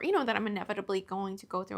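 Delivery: normal talking voice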